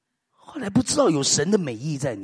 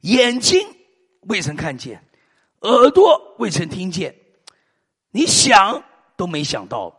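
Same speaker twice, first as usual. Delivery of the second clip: slow preaching emphasis; 6 dB louder, slower than usual. Acted.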